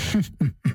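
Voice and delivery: low amused hum-laugh; 低沉地笑; low chuckle. Acted. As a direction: chuckle knowingly through the nose. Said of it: "狄仁杰笑了".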